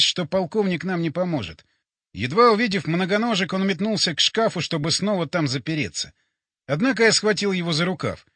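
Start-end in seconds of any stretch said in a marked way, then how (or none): none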